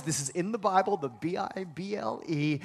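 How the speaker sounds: usual speaking voice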